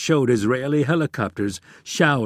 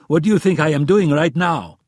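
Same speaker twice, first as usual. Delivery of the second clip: loud, higher-pitched indignant question; exasperated; 5 semitones higher, 7 dB louder than usual. A narrator's reading. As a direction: throw the question back in exasperation. Exasperated retort in acted quarrel.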